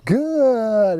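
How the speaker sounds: long, evenly stretched "Good", falling steadily; drawn-out marker word. Shown as a demonstration. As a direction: stretch "Good" out slowly and let it glide down. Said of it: "notice that consistent time pattern".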